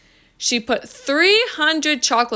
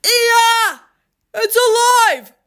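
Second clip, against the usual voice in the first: loud, high shriek of terror; comic scream; 12 semitones higher, 6 dB louder, slower than usual. A narrator's reading. Man finding the doll is real, screaming. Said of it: "The man screamed".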